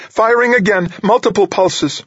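very high-pitched, tense report; urgent high voice. A narrator's reading. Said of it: "she muttered".

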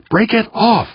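high, contained scream; screamed order. A narrator's reading. Skipper to worker at the stuck latch, screamed order. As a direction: cry it out urgently, far up in pitch. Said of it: "the skipper screamed at him".

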